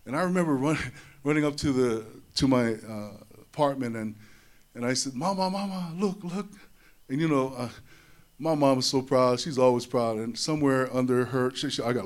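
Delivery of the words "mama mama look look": higher, eager calls to mama; eager kid wanting to be seen; excited child. Retold or imitated. Boy runs to mom with trophy, high and eager.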